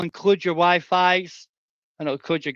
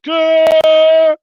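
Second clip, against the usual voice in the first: high, loud hammed-up growl; mock growl; 14 semitones higher, 15 dB louder than usual. Streamer hyping chat, loud playful growl. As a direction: growl it out like a snarling dog. Growls after "big up the bastard.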